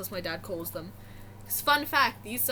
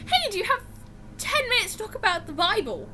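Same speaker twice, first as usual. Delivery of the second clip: high sweet missionary; 9 semitones higher, faster than usual. Retold or imitated. Missionary voiced again, high.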